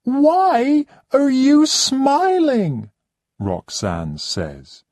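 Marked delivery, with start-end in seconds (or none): 0.0-2.9 much higher and louder, slow indignant demand; furious diva demanding an answer; offended high-pitched outrage; angry. Acted. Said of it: "She's very angry".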